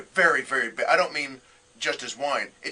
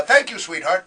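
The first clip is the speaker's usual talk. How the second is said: loud, unsmooth endearment; clumsy attempt at a tough guy's endearment; can't pull it off; 2 semitones higher, 7 dB louder than usual. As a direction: say it loudly, without any of the cool. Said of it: "it's just not in my nature".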